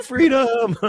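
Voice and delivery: mock Braveheart cry, pitched far up; hammed-up Scottish war cry; William Wallace battle cry. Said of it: "Are you William Wallace?".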